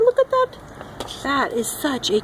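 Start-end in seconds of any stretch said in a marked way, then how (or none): 0.0-0.6 high, amazed look at that; excited. Real, not acted. Excited at froglets.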